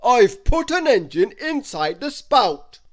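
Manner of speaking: loud, slow booming giant, pitched high; a giant's big, slow welcome; Wizard Winterbottom welcoming. Acted. Giant wizard welcoming Christmas into his castle, loud and slow.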